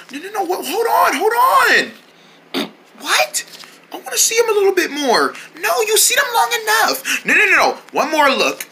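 put-on high, pleading child; whiny kid voice. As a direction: beg like a small kid. Kid figures bickering, higher.

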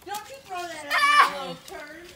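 loud scolding yell; angry shout. Real, not acted. Mom scolding kid throwing snow.

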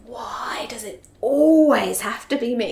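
drawn-out exasperated moan, swelling on "always"; exasperated mum. Acted. Mum voicing her inner complaint about always leading, exasperated. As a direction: stretch it out like a fed-up complaint. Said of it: "we feel that sense of".

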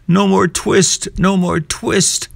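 high, slow feverish mumble; dream mumble. A narrator's reading. Sick tailor mumbling in his dreams, high and slow. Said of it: "in his dreams he mumbled".

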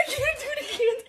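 high, squeaky laugh-cry through the words; giggling in real pain; half laughing, half whimpering. Real, not acted. Laughing while failing to wax.

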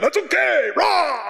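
high shout breaking into a roar; hammed-up game-day yell. Player who knocked over cheerleaders, hammed-up high yell. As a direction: brush it off and roar onward. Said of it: "they fall down".

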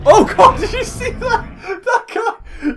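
high, slow words through laughter; giggling disbelief; laughing. Real, not acted. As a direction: squeak it out laughing. Laughing at game moment.

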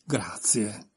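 curt, clipped thank-you; terse grudging thanks; laconic thanks. A narrator's reading. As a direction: say it short and dry. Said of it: "rispose con un laconico".

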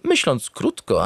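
ordinary delivery

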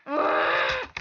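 loud wordless cry of exasperation; loud exasperated holler; frustrated scream. Real, not acted. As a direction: yell out in gaming frustration. Screams at game failure.

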